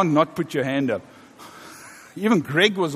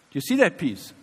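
ordinary talk

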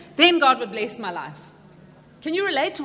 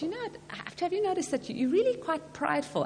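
softer, higher, careful voice; tentative criticism; 5 semitones higher, 7 dB quieter than usual. Acted. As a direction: break it to them gingerly. Someone gently points out a fault.

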